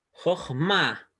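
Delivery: "jochma" with the weight on "ma"; stress on final syllable. Shown as a demonstration. Teacher presenting a Hebrew word to learners, "jochma" stressed on "ma". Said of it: "aquí ma sí se acentúa".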